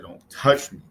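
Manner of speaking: loud, snapped warning; sharp warning. Acted. John warning Arlo not to touch him, loud. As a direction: bark it like he's recoiling from a touch. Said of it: "And he said".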